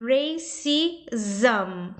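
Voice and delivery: "racism" slowly, broken up as "ray-si-zum"; "racism" drawn out with a clear "zum" ending. Shown as a demonstration. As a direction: model "racism" syllable by syllable, ending in "um". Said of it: "M S fica com som de um".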